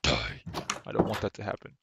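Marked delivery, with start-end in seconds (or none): 0.0-0.4 sudden low menacing drop; mock monster threat. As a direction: drop the voice deep for the kill word. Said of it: "they're like".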